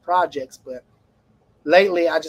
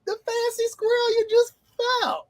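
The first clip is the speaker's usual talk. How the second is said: high, mock-scolding question; nagging inner voice. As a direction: tease yourself in a squeaky voice.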